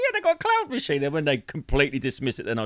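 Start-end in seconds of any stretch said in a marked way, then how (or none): 0.0-1.0 higher-pitched, unconvincing insistence; pitched-up throwaway nonsense claim; deliberately sounding bogus. Shown as a demonstration. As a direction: insist on it like a silly tall tale. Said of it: "so it sounds like bollocks".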